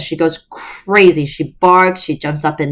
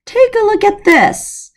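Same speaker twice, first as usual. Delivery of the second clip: much higher, lively showing-off; animated declaration. Acted. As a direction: announce it with animated flair. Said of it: "animatedly declared".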